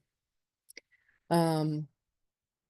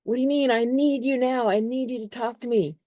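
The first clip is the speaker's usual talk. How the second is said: higher, pleading and clingy; mock needy plea, pitched up; needy Cancer voice; 7 semitones higher than usual. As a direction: beg for attention like a needy partner. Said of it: "and it's like".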